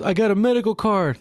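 high-pitched imitated protest; mock-defensive high reply; driver's plea. Acts the flustered driver pleading.

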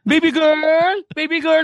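put-on high, loud sing-song baby girl; mock doting dad calling a little girl; doting parent. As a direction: call out like a gushing parent. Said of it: "O, di ba?".

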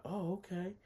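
high-pitched, taken-aback acceptance; retold surprise. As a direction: act out being caught off guard, voice up high. Retold surprised reaction to her answer.

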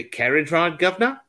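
Cockney cabbie accent; cheery London Cockney. Shown as a demonstration. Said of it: "it's easy to say".

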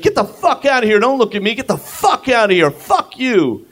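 high mock yelling; angry stranger. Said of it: "he's like".

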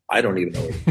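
low, soft aside; quiet admission. Retold or imitated. Comic answers puzzled viewers about his show, quiet admission. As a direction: drop it down as a resigned comeback.

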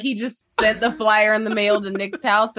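normal talking voice

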